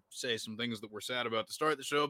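usual speaking voice

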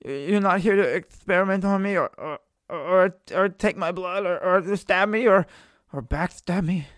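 high, halting, stammered suspicion; fearful captive. Acted. Chained prisoner questioning strangers' intentions, nervous. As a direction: stumble anxiously through each dread.

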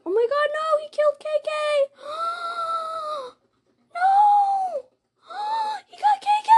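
high, loud shrieks of horror; panicked screaming. Real, not acted. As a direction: scream as your friend dies. Teammate killed by the monster.

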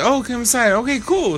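light, high mimicry of a welcoming friend; playful mock host voice. Voicing host and guest.

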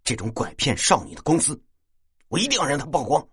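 义愤填膺，越说越高; 咬着字狠狠地表态，句尾拔高. A narrator's reading. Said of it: "倪大很愤怒地说". (愤怒地说)

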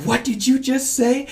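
high-pitched mock disbelief; incredulous doctor. Doctor questioning the dad's remark about his baby, incredulous. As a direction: imitate a doctor taken aback.

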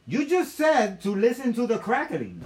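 loud, high comic indignation; exasperated outburst. Retold or imitated. Arguing with the woman over crack, loud exasperation. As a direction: throw her words back at her, incredulous.

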